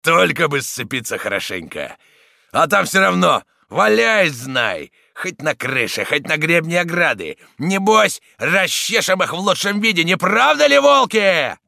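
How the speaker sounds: loud urging, surging to a high shout; rousing harangue. A narrator's reading. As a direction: work the crowd up to a final cry. Leader rousing his wolves to fight.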